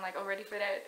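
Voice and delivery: usual speaking voice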